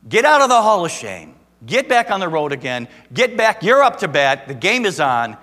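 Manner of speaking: rally him like a coach; booming sermon exhortation; rousing shout. Acted. Jesus urging Peter back, preached.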